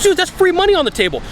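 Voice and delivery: very high-pitched incredulous reaction; playful, high-voiced exasperation; mock disbelief. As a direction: exclaim at an obvious missed chance. Acted disbelief at salesperson's answer.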